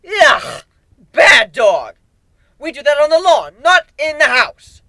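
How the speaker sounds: high, loud, unhinged scolding; crazed owner telling off a puppy; manic scolding of a pet. Acted. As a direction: scold the monster like a naughty dog. Scolding monsters like pets.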